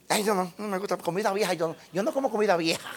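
a lightly voiced, soft, fast, fussy, snobbish whine; a soft, quick mock of a food snob refusing old food; mock picky eater. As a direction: mimic a spoiled person turning up their nose. Mocking a picky wasteful eater.

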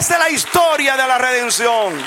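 very high, loud, drawn-out aleluya; preaching shout. Acted. Preacher shouting praise to the congregation, full force. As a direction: call the church to praise.

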